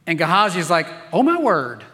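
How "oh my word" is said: mock-alarmed yelp, pitch leaping on "my"; a startled, hammed-up exclamation; alarmed servant. Servant sees the army around the tent, mock alarm.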